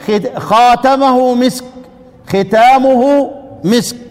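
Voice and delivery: slow خاتمه then ختامه, each drawn out; teacher contrasting readings slowly; contrasting two readings. Shown as a demonstration. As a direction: set the two readings side by side.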